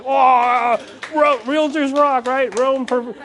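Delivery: high, hammed-up excited cheer; mock excited pride. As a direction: play it like a realtor hungry for applause. Imagined proud realtor, excited high.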